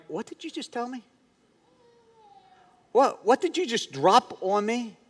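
high, hushed incredulous question; stunned disbelief. Retold or imitated. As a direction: act out being softly floored by bad news. Stunned patient questioning doctor, retold.

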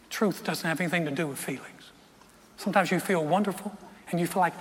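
ordinary delivery

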